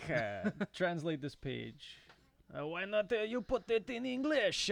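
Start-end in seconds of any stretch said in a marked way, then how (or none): 2.6-4.7 higher French-Canadian put-on voice; Yvonne Peterson. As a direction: squeeze it up into a joking Québécois voice. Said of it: "You sound like Yvonne Peterson".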